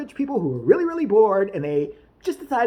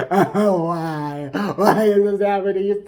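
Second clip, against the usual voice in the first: mock sobbing fan; 3 semitones lower, 3 dB louder, slower than usual. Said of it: "Some of these people".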